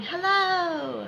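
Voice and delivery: high, drawn-out mild mock scream. Shown as a demonstration. Coach having students picture themselves screaming, mildly mock-screamed. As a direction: call it out high without really screaming. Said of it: "see yourself screaming".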